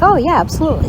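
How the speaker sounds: bright, high, eager agreement; eager yes. Retold or imitated. Telling sister's boyfriend she'd love to see the camper, eager. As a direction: jump at the offer, mock-keen.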